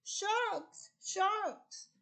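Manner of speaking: high, urgent fish voice warning of sharks; frantic little fish. Retold or imitated. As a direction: squeak out the alarm like a panicked small fish. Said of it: "a fish comes up Oscar's elevator and says".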